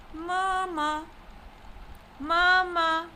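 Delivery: "mama" with both syllables held high and level. Shown as a demonstration. Teacher showing the word for mother, "mama" in first tone. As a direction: keep "mama" on one steady note. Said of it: "pronounced using a high level tone".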